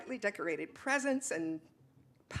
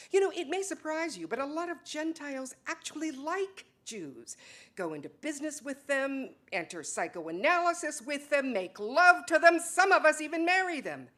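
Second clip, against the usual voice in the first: heated retort; 6 semitones higher, 4 dB louder than usual. Acted.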